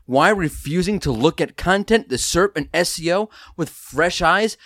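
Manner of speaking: upbeat announcer read over music; podcast host intro. Acted. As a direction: sell the show like a radio host.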